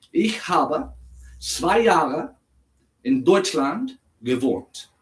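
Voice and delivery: slow, careful German for a learner. Shown as a demonstration. Asked to speak slowly, slows his German down. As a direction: spell it out slowly for the viewer. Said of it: "ich kann etwas langsamer sprechen".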